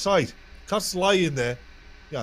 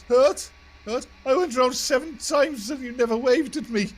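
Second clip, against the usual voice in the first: high, loud, hurt indignation; offended friend; 12 semitones higher, 5 dB louder than usual. Retold or imitated. Injured friend complaining to Tartan, high and aggrieved. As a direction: pitch it way up like a sulking kid. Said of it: "He goes".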